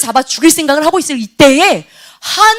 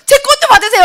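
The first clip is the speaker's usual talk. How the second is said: eager fan; 11 semitones higher than usual. Retold or imitated. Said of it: "하겠죠".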